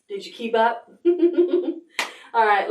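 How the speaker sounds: ordinary talk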